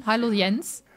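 usual speaking voice

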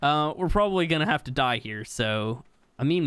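ordinary talk

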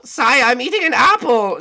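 high, loud whiny servant voice; whiny guard. Acted. Woken by his captain, a high loud whiny guard. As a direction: whine up high at being woken.

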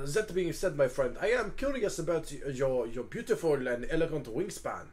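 put-on inquisitive French-accented character; Hugo, curious and flattering. Hugo asks the dragon about flying, curious French accent. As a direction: probe politely with flowery praise.

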